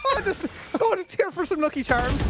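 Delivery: hammed-up Irish accent, pitched high; mock stage-Irish accent; strong Irish accent. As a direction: lay the Irish accent on thick as a joke. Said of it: "I had to put on a really strong accent".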